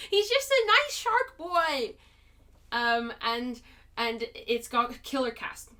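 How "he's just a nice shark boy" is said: high, drawn-out whine through laughter; laughing high-pitched protest; playful pleading defence. Real, not acted. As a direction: plead his case, slow and high.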